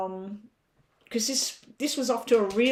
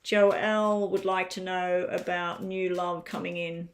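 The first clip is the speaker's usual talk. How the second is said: normal talking voice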